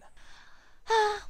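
long tired sigh; weary sigh. Acted. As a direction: let out a heavy sigh of resignation. Tired sigh before work.

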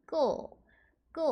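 끄 as a tense k closing on a dark L; KLE as "kkl". Shown as a demonstration. Teacher sounding out K-L-E for learners, 끄 as tense kkl. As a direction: say 끄 twice, each separate, like "kkul". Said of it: "이렇게 발음하셔서".

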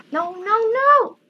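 panicked. Real, not acted. Getting hit in game.

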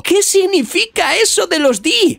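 mock-alarmed high-pitched query; worried. A narrator's reading. Vivi puzzled by the message, worried high-pitched question. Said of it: "muy preocupada también y diciendo".

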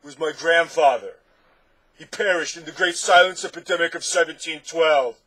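loud, high lament; anguished cry. A narrator's reading. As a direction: wail it out like a bereaved monster. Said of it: "cried the anguished Din".

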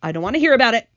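loud, distressed outburst; mild shriek. A narrator's reading. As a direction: refuse loudly as the frightened earthworm. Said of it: "shrieked the poor blind earthworm".

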